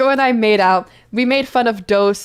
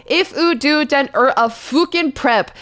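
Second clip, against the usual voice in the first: mock angry note; 7 semitones higher than usual. Reading author's angry note.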